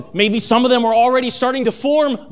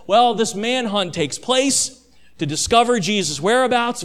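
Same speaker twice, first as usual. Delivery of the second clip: normal talking voice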